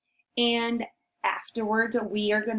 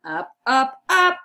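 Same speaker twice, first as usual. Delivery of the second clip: louder, rising pitch on each word; climbing up; 4 semitones higher, 7 dB louder than usual. Acted. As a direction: step the voice higher each time. Acts out climbing up.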